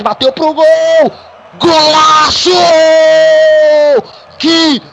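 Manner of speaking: screamed goal call, high and loud; elongated, drawn-out goal celebration shout; explosive goal commentary. Real, not acted. Goal scored, commentator erupts.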